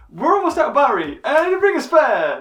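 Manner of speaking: rushed high excitement; excited, high and loud. Real, not acted. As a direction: blurt it out brightly. Excited confession.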